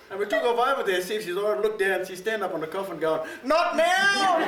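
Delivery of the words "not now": high, loud retold yell; imitating a startled outburst; mock shout. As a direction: yell it like the auntie in the grave. Auntie shouting from coffin.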